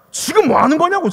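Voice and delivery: high, loud retold challenge; mock scolding. Evangelist challenging temple-goers, mock scolding. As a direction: imitate an outraged demand, voice shooting up. Imitates evangelist confronting strangers.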